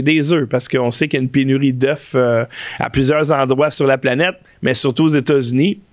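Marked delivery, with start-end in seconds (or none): none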